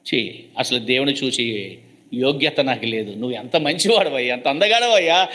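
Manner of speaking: a lightly voiced humble Job; voicing Job. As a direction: mutter it as Job despising himself. Job voiced in sermon retelling.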